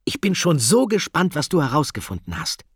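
high, loud, eager cry; excited call. A narrator's reading. Ida can't wait to hear Katinka's findings, excited call. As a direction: burst out with it, bubbling with curiosity. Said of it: "rief Ida".

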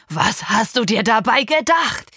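loud, angry yell at the daughter; father shouting. Acted. Father yelling at his pregnant daughter, loud and angry. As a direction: demand it furiously. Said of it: "schrie er mich an".

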